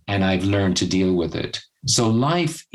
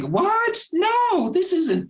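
very high, protesting squeal at normal volume; mock disbelief; much slower than usual. Mimicking locals when the cold suddenly returns, mock disbelief. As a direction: play indignant shock, pitched way up.

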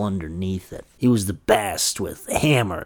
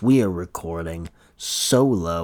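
ordinary talk

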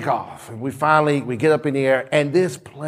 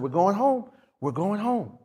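soft reassurance; 6 dB quieter than usual. Retold or imitated. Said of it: "I said".

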